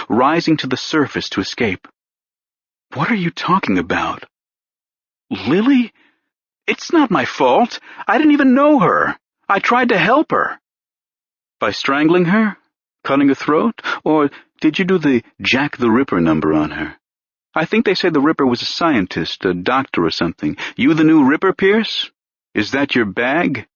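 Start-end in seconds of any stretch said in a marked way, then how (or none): 2.9-10.6 panicked denial. Acted. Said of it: "Pierce felt fear and danger flooding his mind". 11.6-23.7 a hint of a needling cop, rising higher as he pushes; lightly voiced pushy detective. Renner taunting Pierce.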